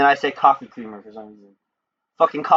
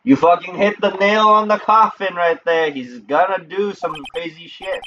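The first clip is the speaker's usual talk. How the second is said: excited reaction; 7 semitones higher, slower than usual. Real, not acted. Reacting to game twist.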